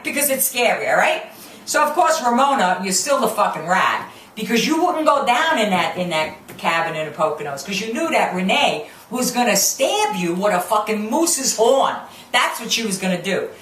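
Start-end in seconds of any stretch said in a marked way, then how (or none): none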